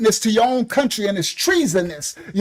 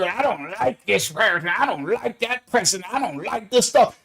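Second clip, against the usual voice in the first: 1 mock complainer; 2 semitones lower, 4 dB quieter than usual. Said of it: "The orange dude".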